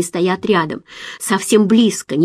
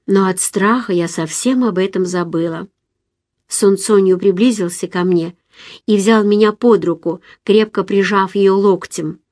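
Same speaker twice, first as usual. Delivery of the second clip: ordinary delivery, nothing marked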